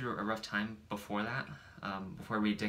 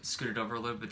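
ordinary talk

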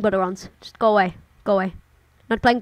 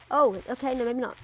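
ordinary talk, nothing marked

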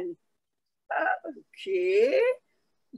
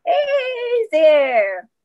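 high, louder sing-song hello; polite greeting; 11 semitones higher, 7 dB louder than usual. A narrator's reading. Girl politely greeting the strange little creature: high sing-song hello. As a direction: greet him sweetly and politely. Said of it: "she's being polite".

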